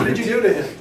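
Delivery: a worried doctor calling the next morning; mock cardiologist. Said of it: "the cardiologist is gonna call me".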